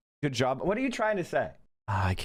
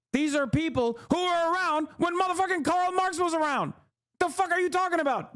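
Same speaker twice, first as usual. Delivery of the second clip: incredulous rant; 9 semitones higher than usual. Real, not acted. Streamer ranting at online critics, high and incredulous. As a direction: voice jumps up in outrage.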